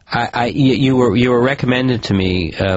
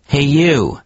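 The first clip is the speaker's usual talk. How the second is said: sudden calling shout, higher; 6 semitones higher than usual. A narrator's reading. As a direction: call out to a stranger. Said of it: "shouted the tortoise".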